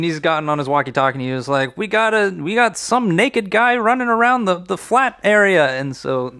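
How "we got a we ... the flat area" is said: radio ranger. Acted. Ranger calls on walkie-talkie.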